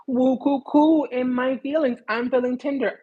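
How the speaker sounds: high, cooing mock-sensitive voice; exaggerated lovey-dovey mockery, high-pitched; mock tender-feelings voice. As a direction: coo it mockingly, way up in pitch. Mocking someone all in their feelings.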